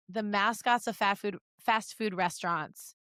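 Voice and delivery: ordinary talk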